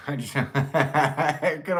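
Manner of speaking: words broken by laughter; giggling through the line; laughing. Real, not acted. Laughing through ventriloquism attempt.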